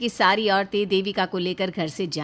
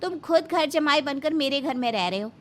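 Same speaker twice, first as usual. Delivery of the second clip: high, raised, scornful scolding; angry retort; 6 semitones higher than usual. Acted. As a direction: tear into your useless husband. Wife angrily retorts to husband.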